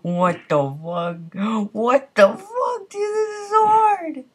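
high, whiny fake sob; mock crying. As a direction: whimper it like a wronged kid. Mock whining about the hard game.

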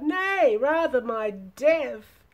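high, loud, drawn-out protest; crying out. A narrator's reading. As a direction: cry out as if death were preferable. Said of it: "he cried".

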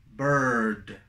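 low, long "bird" with central er; contrast drill. Shown as a demonstration. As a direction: close the list on a falling "bird". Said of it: "Así sería como se tiene que hacer". ("bird" con vocal central, descendente)

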